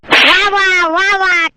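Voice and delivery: slow, drawn-out sarcastic praise; mock applause. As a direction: stretch each वाह out mockingly. Mocking the boss's joke. (धीमे खिंचे व्यंग्य भरे वाह)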